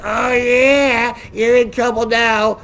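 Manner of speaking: lightly mocking told-you-so sneer; smirking taunt. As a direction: gloat like a bystander who thinks he's caught. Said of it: "start clapping and smirking like".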